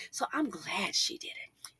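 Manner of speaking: voiceless whispered aside. Real, not acted. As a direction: hush it out like a secret. Quiet aside of approval.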